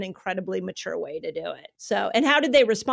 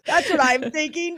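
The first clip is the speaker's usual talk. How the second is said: laughing through the words, a touch louder; 6 semitones higher, 6 dB louder than usual. Real, not acted. Agreeing with her co-host about the staff, laughing. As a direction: chuckle it out in agreement.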